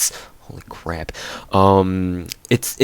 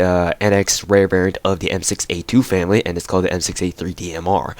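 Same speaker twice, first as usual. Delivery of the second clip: ordinary talk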